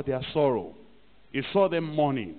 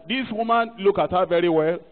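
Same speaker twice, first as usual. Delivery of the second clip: high, loud finger-pointing accusation; accusing; 5 semitones higher, 5 dB louder than usual. Acted. Church member exposing a woman to the guys, loudly accusing. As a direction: call everyone's attention to the culprit.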